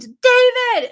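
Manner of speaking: high, loud scream for help; screamed name. Retold or imitated. Woman screams for her partner in the dream, panicked scream. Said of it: "And I screamed".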